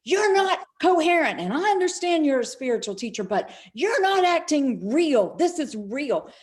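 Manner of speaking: loud, high, angry lecture; retold yelling. Friend Bear yelling over the phone, loud scolding. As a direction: scold her hard for not being real. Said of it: "he's just yelling at me".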